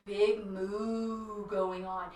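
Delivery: deep. Shown as a demonstration. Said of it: "So really deep".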